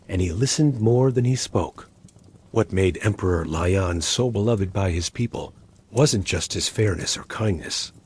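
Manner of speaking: plain reading voice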